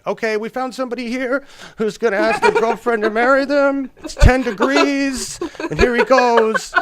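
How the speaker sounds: high, loud live-event announcer; hammed-up host, pitched high and loud; mock TV host. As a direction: hype up a staged proposal. Mimics host announcing rushed proposal.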